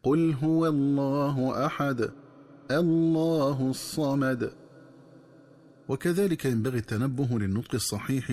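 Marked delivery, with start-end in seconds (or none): 0.0-4.5 slow recitation, stop on أحد, then الله starts with a hamza and a heavy lam; recited with a pause. Shown as a demonstration. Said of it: "تكون القراءة ... هكذا". (تلاوة بالوقف على أحد وتفخيم لام الله)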